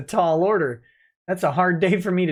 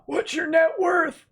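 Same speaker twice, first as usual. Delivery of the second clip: squeaky high; 14 semitones higher, slower than usual. Acted. Reading a chat question, voice shoots up.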